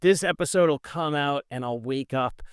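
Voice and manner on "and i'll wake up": ordinary talk, nothing marked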